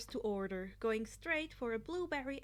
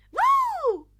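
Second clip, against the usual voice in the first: very high, loud whoop; cheering; 18 dB louder than usual. Acted. Mina cheering at being picked, very high whoop. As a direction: shriek with glee. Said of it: "Mina cheered".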